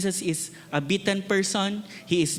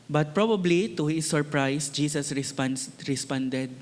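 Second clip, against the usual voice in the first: ordinary talk, nothing marked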